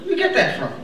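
high, loud disbelief at "African subtitles"; incredulous. Real, not acted. Comic asking the crowd where anyone downloads African subtitles, high and incredulous. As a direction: throw the question up high in disbelief.

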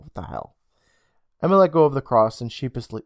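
ordinary delivery, nothing marked

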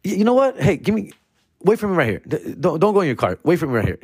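high, bossy order to wait; hammed-up cop giving orders; mock cop ordering. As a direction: boss him around like a petty cop.

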